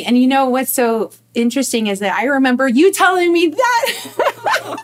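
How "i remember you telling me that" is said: higher, louder, tipping into a laugh; breaking into laughter. Real, not acted. Remembering the listener once told her that, breaking into laughter. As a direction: let real laughter take over by the end.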